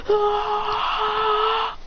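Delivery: long, very high scream at contained volume; mock scream. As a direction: scream as if overloaded with energy, held back. Absorbs too much energy, screams.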